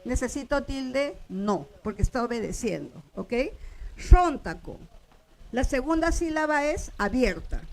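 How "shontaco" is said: Shontaco with a loud opening "Shon"; stress on first syllable. Shown as a demonstration. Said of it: "yo acentúo la primera".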